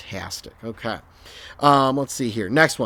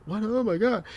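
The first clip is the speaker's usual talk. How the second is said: surprised; 10 semitones higher than usual. Real, not acted. Can't find the factors.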